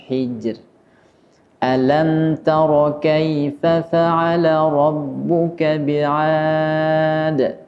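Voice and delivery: slow chanted tilawah; measured Quranic chant; Quran recitation. Acted.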